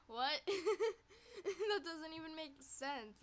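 loud incredulous laugh; laughing disbelief. Real, not acted. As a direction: laugh at the absurdity.

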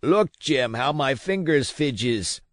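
put-on pleading old captain, drawn out. A narrator's reading. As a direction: beg the boy to look at your hands. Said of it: "he continued in the pleading tone".

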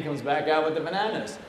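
plain reading voice

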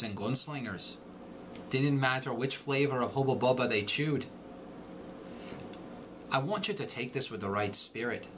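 Irish brogue monologue reading; teacher reading a monologue in an Irish accent. Shown as a demonstration. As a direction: read it in a light Irish brogue. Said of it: "I'll read it for you now with the accent".

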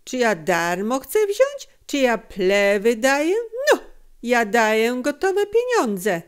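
loud, rising protest; mounting string of indignant questions; indignant dealer. A narrator's reading. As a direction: argue back offended. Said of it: "szarpnął się handel".

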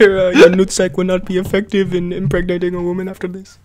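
deadpan verdict in a put-on French accent; Francis Ngannou. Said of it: "He speaks with, like, a French accent".

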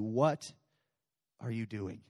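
low, hushed direct question; soft searching question. Acted. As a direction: lean in and ask softly. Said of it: "I speak to somebody in this room".

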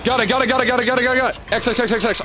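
high, rapid repeated got it; frantic excitement. Real, not acted. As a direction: hammer the words out in a frenzy. Frantic button prompt in fight.

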